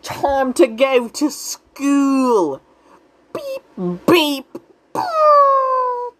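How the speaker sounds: drawn-out, very high cartoon owl voice; Hooty. Recapper mimics Hooty announcing school, high cartoon squeal. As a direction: squeal it high and stretch the words out.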